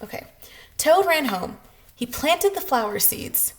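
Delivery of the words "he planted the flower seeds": plain reading voice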